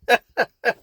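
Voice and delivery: a short high burst of real laughter. Real, not acted. Telling viewers about his dad's mishap, real laugh. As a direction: break into a laugh at the memory.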